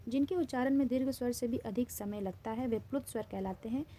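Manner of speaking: usual speaking voice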